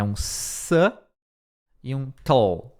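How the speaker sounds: "s" as a short SUH, pause, then "tol"; broken-down pronunciation. Shown as a demonstration. Teacher breaking subtle down for learners, "s" and "tol" apart. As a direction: split it in two: "s" (SUH) ... "tol". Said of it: "pronúncia é".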